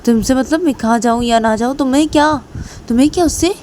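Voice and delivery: high-pitched, rising defiance; defiant retort. Acted. Kajal telling Reshma it's none of her business, high defiant retort. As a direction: throw it back indignantly.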